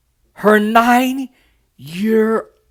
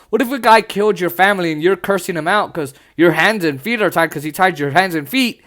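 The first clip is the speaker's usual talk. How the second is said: ordinary talk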